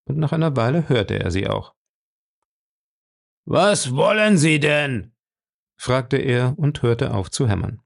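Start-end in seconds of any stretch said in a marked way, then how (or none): 3.5-5.2 loud, slow demand; drawn-out annoyed question; irritated call back. A narrator's reading. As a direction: call back slowly with raised pitch. Gruff captor answers her shout.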